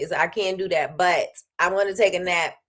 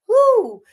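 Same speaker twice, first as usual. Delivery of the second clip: a sudden high loud whoop; 8 dB louder than usual. Real, not acted. Vlogger whoops about the mountain curves, high and loud. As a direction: whoop at the wild mountain roads.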